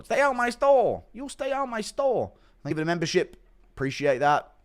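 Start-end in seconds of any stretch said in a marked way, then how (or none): 0.0-2.3 high, slow shopkeeper shouting him off; pitched-up stern store owner, slow and firm; Rongju impression. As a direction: chase him out of the shop, drawn out. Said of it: "Impression of Rongju finding out".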